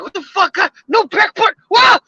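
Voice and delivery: high, incredulous squeak at the phone; baffled outburst. Retold or imitated. A driver baffled by his new phone's missing back button, high incredulous voice. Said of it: "Yeah, you're like".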